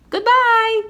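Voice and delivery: very high, loud call; calling goodbye. Acted. Shopkeeper calling to flying boy, very high loud goodbye. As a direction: sing out the goodbye from the window. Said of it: "called Lucy from the window".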